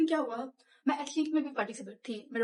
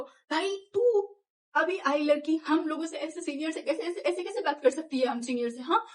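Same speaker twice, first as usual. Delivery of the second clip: high, loud bullying telling-off; mock angry seniors; 5 semitones higher, 5 dB louder than usual. Senior boys ganging up on a junior girl, loud mock scolding. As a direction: bark down at the junior girl, pitched way up. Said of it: "उन दस बारह लोगों ने हमको ऐसे झाड़ा".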